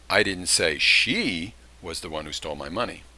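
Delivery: "she" punched, the rest level; stress on she. Shown as a demonstration. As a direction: hit the word "she". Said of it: "That would intimate that there was somebody else".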